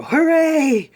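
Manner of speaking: high, loud drawn-out hooray; whole class cheer in one shout. Acted. Kids told they are going outside, cheering.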